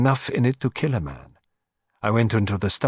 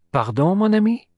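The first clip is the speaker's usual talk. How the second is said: higher, inquiring Pardon; puzzled; 10 semitones higher than usual. Acted. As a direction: lean in asking innocently. Poirot puzzled.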